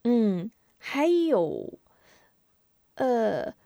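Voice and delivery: put-on slow, drawn-out child's hesitation; thinking hard. Boy searches memory for grandmother, slow hesitant voice. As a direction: stretch the words while searching memory. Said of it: "吴忠又想了想".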